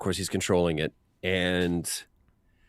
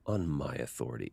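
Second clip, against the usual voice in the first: first read; 6 semitones lower, 9 dB quieter than usual. Retold or imitated. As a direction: lower and softer, calm and understated. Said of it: "The first read that, that Kevin gives".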